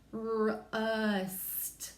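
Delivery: rust drawn out very slowly, each sound held. Shown as a demonstration. Teacher modelling a word for spelling, drawn out slowly.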